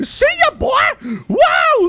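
high, loud victory scream; excited scream. Real, not acted. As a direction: scream it after the kill. Celebrating kill, screaming.

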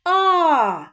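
"А" held long, high and loud; isolated vowel. Shown as a demonstration. Teacher answers where stress falls, long loud А. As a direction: call out a stretched "А". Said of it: "на другий склад звука А".

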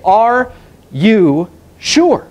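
preacher posing the key question to a stranger; probing question. Retold or imitated. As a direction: press the question gently but firmly. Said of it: "put it right there in front of them and say".

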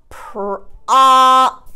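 ordinary talk, nothing marked

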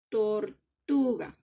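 Tortuga broken slowly into syllables, weight on TU; stress on TU. Shown as a demonstration. Teacher repeating for pupils, Tortuga slowly with stress on TU. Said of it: "una sílaba que suena más duro".

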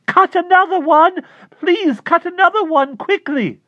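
very high and loud, urgent begging; frantic high plea. Acted. Klausner begging his neighbor to cut a rose, frantic shout. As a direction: plead frantically at the top of the voice. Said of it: "calling to her in a voice so high and loud".

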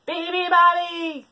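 slow, loud booming call; thunder. Acted. Moose wake the bird, booming. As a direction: boom it out. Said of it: "thundered the moose and the water buffalo".

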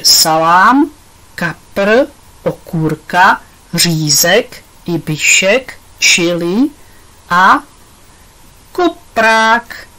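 item by item, with pauses and lifted endings; slow listing. A narrator's reading. Pipa explains her made-up acronym to Tonda, listing slowly. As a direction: list each word separately, drawing it out.

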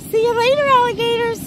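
high cutesy dog sign-off; voicing Monroe the dog. Owner voicing her dog's goodbye to viewers, high and cute. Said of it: "Monroe's gonna say".

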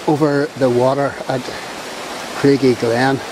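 ordinary delivery

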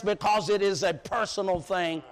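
ordinary delivery

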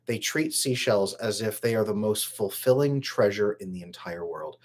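usual speaking voice

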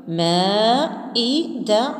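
مائدة stretched very slowly, syllable by syllable; slow blending. Shown as a demonstration. Teacher blending the syllables into one word, very slow. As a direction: draw out مائدة so the pieces join. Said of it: "ندمج".

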